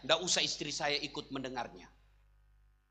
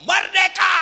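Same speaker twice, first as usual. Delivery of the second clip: high, loud joyful yell; triumphant shout; 13 semitones higher, 15 dB louder, slower than usual. Retold or imitated. Widowed husband alone in his room, mock triumphant shout. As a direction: roar it like a victory cry. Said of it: "suami masuk kamar berteriak".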